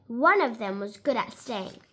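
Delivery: ordinary delivery, nothing marked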